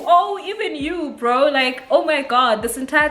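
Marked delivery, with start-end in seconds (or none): 0.1-1.5 hurt disbelief. Retold or imitated. Disbelief at second friend.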